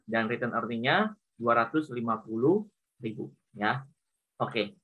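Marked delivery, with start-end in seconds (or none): none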